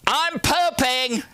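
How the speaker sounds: very high, drawn-out singsong; mock high-pitched announcement; old mother announcing. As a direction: imitate mom calling out slowly in a high voice. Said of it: "there's two words that are worse".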